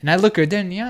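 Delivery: ordinary talk, nothing marked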